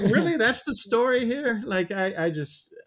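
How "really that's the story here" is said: high, loud mock disbelief; retold "you can't be serious" reaction; incredulous. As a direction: scoff at it in a raised pitch. Retells own disbelief at plot.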